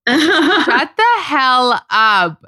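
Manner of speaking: slow, laughing "shut up"; stunned disbelief. Real, not acted. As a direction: drag it out in amazed disbelief. Disbelief at her age.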